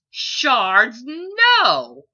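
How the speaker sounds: put-on high, loud, urgent child's cry; little dragon trilling in alarm; agitated trill. A narrator's reading. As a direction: trill it out in a panic. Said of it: "the Ember trilled in agitation".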